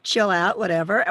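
ordinary talk

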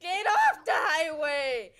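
loud, drawn-out order; exasperated yell. Real, not acted. Player ordering Danny off the road, exasperated yell. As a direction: shout it slowly at the teammate. Yelling directions at a teammate.

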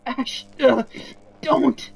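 loud, high cry of alarm; desperate plea. Acted. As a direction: beg them to stop. Pleading with Ash not to die.

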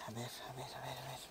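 whispering to herself while she works; whispered muttering. Real, not acted. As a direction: breathe it out under her breath, absorbed in the task. (susurrando para sí misma mientras trabaja)

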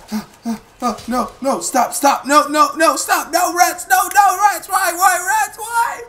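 rising high panicked screams; panicked screaming. Real, not acted. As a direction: build from stammer to shrieks. Rats attacking, building panic.

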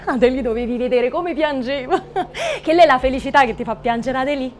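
talking through laughter to the bride; laughing mother comforting her crying daughter; laughing fondly. Acted. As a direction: laugh it off tenderly.

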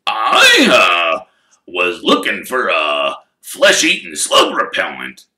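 loud gruff growl, flustered; Hagrid growling. Acted. Hagrid caught out by Harry's question, with a loud flustered growl. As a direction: bluster like a caught giant. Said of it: "growled Hagrid".

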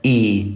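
إي as a clear "ee"; long ee vowel. Shown as a demonstration. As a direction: correct it to إي, said "ee". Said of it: "راح يلفظ إي".

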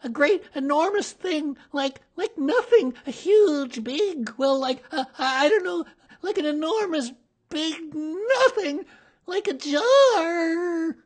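high, loud, stammering panic; tiny animal gabbling in fright; flustered Piglet. A narrator's reading. Panicked Piglet describes the monster.

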